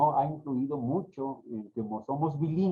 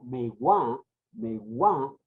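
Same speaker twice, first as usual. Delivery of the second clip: megua said as me-GUÁ, final syllable stressed; accent the end of megua; stress on last syllable; 3 semitones lower, much slower than usual. Shown as a demonstration. Teacher showing the accented form, megua stressed on the end. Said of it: "cuando yo lo acentúo un poco".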